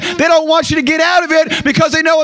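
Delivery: frantic high shout of encouragement; urgent shouting. Retold or imitated. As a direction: shout it like a voice pulling him back. Voice urging him in crisis.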